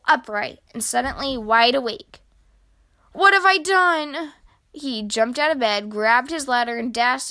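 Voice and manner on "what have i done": high, loud, dismayed cry; horrified realisation. A narrator's reading. Grumpy Badger suddenly realises Mole is in danger, horrified. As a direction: blurt it out in alarmed regret.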